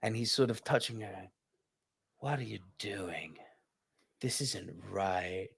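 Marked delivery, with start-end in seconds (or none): 2.2-5.5 whispered, wooden line reading; mock flat bad acting. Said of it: "every single tonal intonation is wrong".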